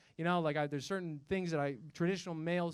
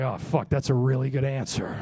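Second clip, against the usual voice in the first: mock stunned admission; 6 semitones lower, 7 dB louder than usual. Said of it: "I was like".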